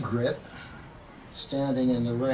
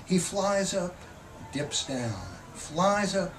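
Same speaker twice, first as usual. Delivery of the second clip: measured, expressive poetry reading; poem reading; 4 semitones higher than usual. Acted. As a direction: read the poem like you're savouring each image. Poet reads the poem Mockingbird.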